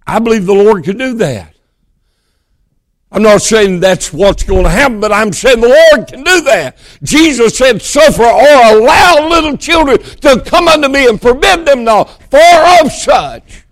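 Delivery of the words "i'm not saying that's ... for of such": high, loud, building sermon delivery; preaching at full force. Real, not acted. Preacher quotes Jesus to the congregation, preaching at full force. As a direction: build the scripture to a ringing height.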